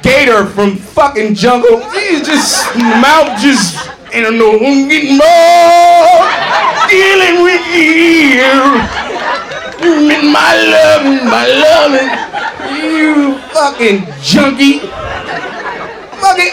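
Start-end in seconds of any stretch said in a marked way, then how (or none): 4.1-12.1 slow, slurred junkie crooning, louder. Retold or imitated. Comic mocking the singer as a slurring junkie. Mocking [name] singing high. 12.8-16.5 laughing insult. Real, not acted. Mock yell at junkie singer.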